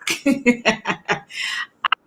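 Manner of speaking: quiet unvoiced chuckle; soft laugh at own joke; soft breathy chuckle. Real, not acted. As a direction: let a little breathy laugh slip out.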